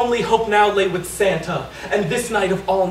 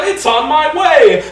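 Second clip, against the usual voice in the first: loud, slow booming Santa; bellowing Santa; 5 semitones higher, 6 dB louder than usual. Acted. Santa offering Jesus a lift, loud booming reply. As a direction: boom it out generously. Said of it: "bellowed Santa".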